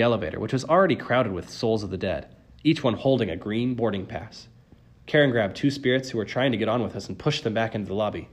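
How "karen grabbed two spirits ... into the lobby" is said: plain reading voice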